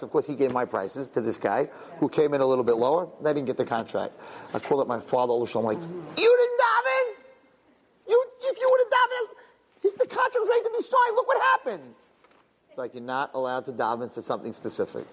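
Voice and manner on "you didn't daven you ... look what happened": high, shouted reproach, fast in the middle; indignant scolding shout. Retold or imitated. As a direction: yell it at him like an outraged son. Scolding his father over the lost contract.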